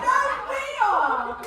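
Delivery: high, drawn-out comic protest; mock protest. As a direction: stretch it out high, mimicking the objectors. Audience retort acted out.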